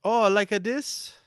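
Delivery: slow, high mock Japanese accent; hammed-up fake Japanese accent; bad Japanese accent. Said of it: "sounds like an American doing a bad Japanese accent".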